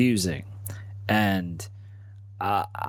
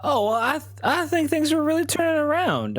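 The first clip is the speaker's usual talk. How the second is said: pitched way up, overly cheerful; high nervous. Acted. Nervous man insisting to the questioner his life was fine, voice pitched up. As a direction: squeak out a too-bright answer.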